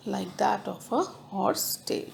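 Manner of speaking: breathy repeat under the breath; whispered. Shown as a demonstration. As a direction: whisper the line to yourself. Teacher repeats line, then whispers it.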